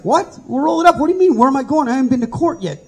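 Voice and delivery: high, alarmed disbelieving questions; startled protest. Retold or imitated. Inmate startled by the cops' order, alarmed disbelief. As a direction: protest in shocked confusion.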